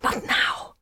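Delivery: urgent unvoiced whisper. A narrator's reading. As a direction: breathe it fast and low. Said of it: "she whispered".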